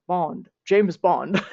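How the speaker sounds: slow, mock British spy drawl in a British accent; rehearsing the British accent before recording a line. Playful British accent warm-up before a take. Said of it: "trying to do the British accent".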